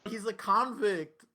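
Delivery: loud, slow, flat-out insistent; indignant. Real, not acted. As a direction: slam it down as a verdict. Heated podcast debate.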